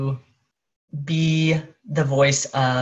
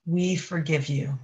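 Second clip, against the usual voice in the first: higher consciousness voice. Acted. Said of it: "the voice of higher consciousness".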